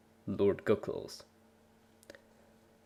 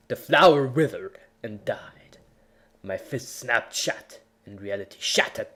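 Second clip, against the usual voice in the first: dramatic story reading. A narrator's reading. Reading his fanfic aloud, overblown epic narration. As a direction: read it like a hammed-up battle saga.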